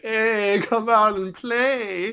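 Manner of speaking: high, slow sing-song menace; mock creepy taunt. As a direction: tease them out like a playground threat. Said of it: "Really fucking intimidating".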